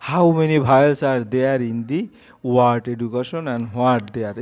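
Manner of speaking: slow, word by word like dictation; dictating a question slowly; slow read-aloud. Shown as a demonstration. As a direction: read it out slowly for pupils to copy. Teacher reads the question slowly.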